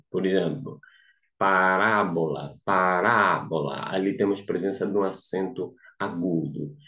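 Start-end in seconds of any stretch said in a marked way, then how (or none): 1.4-3.9 "parábola" devagar, com RÁ forte e alongado; maior intensidade na tônica. Shown as a demonstration. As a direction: carregue no RÁ de "parábola". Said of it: "ler com maior intensidade". (ênfase didática na sílaba RÁ de "parábola")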